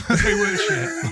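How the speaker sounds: high, slow laughing through it. Real, not acted. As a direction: laughs as the words come out. Laughing about bad aftershaves.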